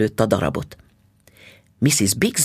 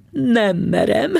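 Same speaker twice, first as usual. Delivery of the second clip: laughing; 9 semitones higher, 3 dB louder, slower than usual. A narrator's reading. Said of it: "nevetett Missus Bixby".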